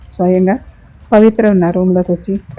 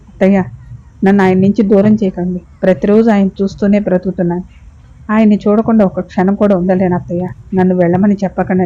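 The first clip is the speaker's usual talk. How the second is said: usual speaking voice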